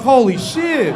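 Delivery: loud, high exclamation of shock; shocked shout. Retold or imitated. Comedian stunned by a heckler's cock on stage, shocked shout. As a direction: blurt it out, stunned.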